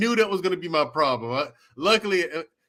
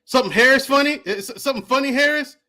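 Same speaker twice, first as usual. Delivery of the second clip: a touch louder, higher drill sergeant bark; lightly voiced drill sergeant; 6 semitones higher, 4 dB louder than usual. Drill sergeant catching a laughing recruit, mild bark. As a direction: snap the question at the recruit. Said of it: "A drill sergeant came to me".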